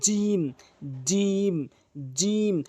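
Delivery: জিম said with the middle of the tongue on the palate; jim demo. Shown as a demonstration. Teacher repeating জিম for students. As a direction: press mid-tongue up and say জিম clearly. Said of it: "জিহ্বার মাঝখানটা উপরে তালুর সঙ্গে লাগালে এভাবে".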